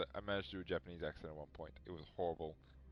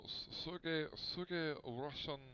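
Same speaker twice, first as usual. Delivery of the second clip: Russian accent; 8 semitones higher, much slower than usual. Shown as a demonstration. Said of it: "See, I can't do Russian now".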